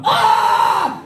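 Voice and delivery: high comic shriek; mock agonised scream. Bath toy tortured under the tap, high mock scream. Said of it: "and he's like".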